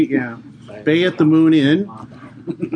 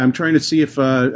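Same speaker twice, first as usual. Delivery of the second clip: ordinary delivery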